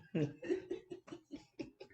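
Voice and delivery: high, breathy giggling; laughing fit. Real, not acted. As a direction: giggle helplessly at yourself. Laughs at her own creamy.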